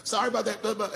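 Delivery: high, hurried mock apology from the police; flustered cops apologizing. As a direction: play the cops backpedaling fast in a high voice. Police apologize after tackling him.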